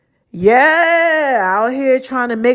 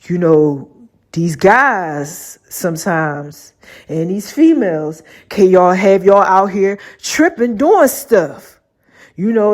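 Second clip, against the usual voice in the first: God's deep voice; 5 semitones lower than usual. Retold or imitated. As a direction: lay it down low like a wise elder. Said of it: "He's like".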